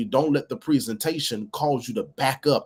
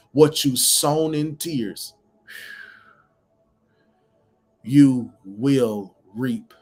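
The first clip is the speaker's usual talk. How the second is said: slow scripture; 4 dB louder, much slower than usual. Acted. Preacher drawing out scripture.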